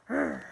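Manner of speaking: bear growl. Acted. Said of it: "Can you guys make your bear noises?".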